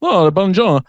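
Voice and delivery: loud, high bonjour in mock French; hammed-up French accent; put-on French accent. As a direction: overdo the French greeting. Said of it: "I don't think you have that accent".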